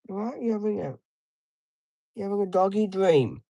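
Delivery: high sing-song pet voice; talking to a dog. Real, not acted.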